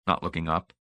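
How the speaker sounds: usual speaking voice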